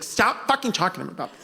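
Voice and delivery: quick, mock-annoyed hiss of a quote; upset wife. Comedian voices audience member's upset wife, quick mock annoyance. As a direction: imitate a fed-up wife, hurried and only mildly cross. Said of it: "the wife's getting upset".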